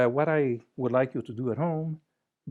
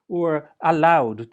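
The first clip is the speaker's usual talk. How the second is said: "allowed" stretched slow and louder; drawn-out allowed; 3 semitones higher, 10 dB louder, slower than usual. Shown as a demonstration. Showing hello echoes "allowed", stretched out. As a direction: lean into "allowed", slowly. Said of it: "sounds like a bunch of other words".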